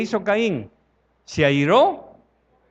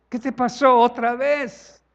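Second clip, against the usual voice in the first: God asking; 7 semitones higher, 3 dB louder than usual. Acted. Dios pregunta al pecador.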